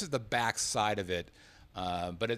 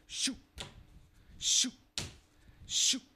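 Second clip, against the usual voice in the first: imitated card machine ejecting cards; machine noise imitation; 12 semitones higher, slower than usual. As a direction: mimic the machine spitting cards.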